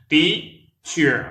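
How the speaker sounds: Teacher said high and loud, TEA stressed, clear ch; two syllables TEA-cher; model pronunciation. Shown as a demonstration. Teacher modelling Teacher for learners. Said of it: "En anglais, c'est teacher".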